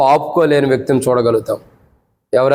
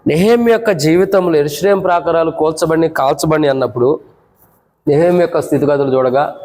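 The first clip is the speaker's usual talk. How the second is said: ordinary talk, nothing marked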